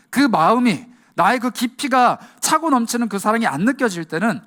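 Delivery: fervent sermon climax; impassioned preaching. Acted. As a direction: preach it to the back row, climbing. Preacher builds to full force.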